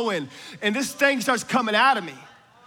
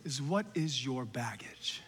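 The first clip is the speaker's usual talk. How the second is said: slow, softer, hushed question; whispered question; 9 semitones lower, 13 dB quieter, slower than usual. Acted. Preacher whispers his first question to the crowd. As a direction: lean in and whisper it slowly.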